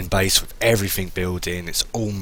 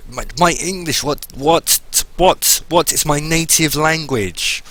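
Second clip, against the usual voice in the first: exasperated disbelief; 8 semitones higher, 6 dB louder, slower than usual. Real, not acted. Mocks own garbled speech.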